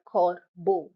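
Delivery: "corbeau" as cor - bo; two separate syllables. Shown as a demonstration. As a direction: break "corbeau" cleanly after cor. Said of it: "two syllables".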